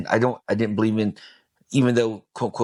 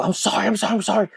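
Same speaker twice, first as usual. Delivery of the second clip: retold crying apology; 9 semitones higher, faster than usual. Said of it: "And I just start crying".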